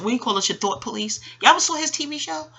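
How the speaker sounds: ordinary talk, nothing marked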